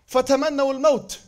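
Arabic verse recited; Quran recitation. Acted. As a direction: recite it in tajweed style.

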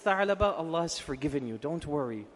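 low, soft comforting voice; gentle reassurance. Retold or imitated. The Prophet tells a boy he's forgiven, soothingly. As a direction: quietly lift the child's worry.

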